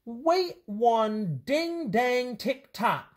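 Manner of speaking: high, drawn-out shout for a nurse character; playful, high-pitched exclamation from the nurse; mild storybook shout. A narrator's reading. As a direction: slowly call it out, stretching each word. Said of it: "shouted the nurse".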